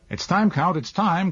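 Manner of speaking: high urgent call; crying out. A narrator's reading. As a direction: call out in haste from horseback. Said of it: "cried the adjutant".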